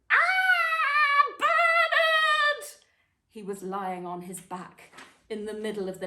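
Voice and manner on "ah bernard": piercing high shriek of the name; horrified scream. A narrator's reading. Mum finds the hamster dead in the nest, shrieking. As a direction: scream it out in shock. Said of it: "she screamed".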